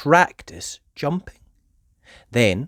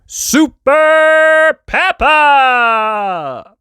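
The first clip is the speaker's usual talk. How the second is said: high, loud, slow; cheerful title shout; 13 semitones higher, 9 dB louder, much slower than usual. Acted. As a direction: sing out the title. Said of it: "narrator performs title".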